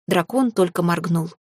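ordinary delivery, nothing marked

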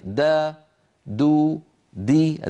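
دا دو دي with clear vowels; voweled daal. Shown as a demonstration. Teacher sounding out daal syllables for the class. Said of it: "الدال كذلك".

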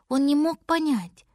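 ordinary delivery, nothing marked

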